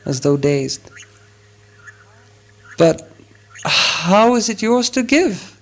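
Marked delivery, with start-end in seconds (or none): 2.8-5.5 halting, slow, rising in pitch; with an effort. A narrator's reading. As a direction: force the question out slowly, with a pause. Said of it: "asked at last with an effort".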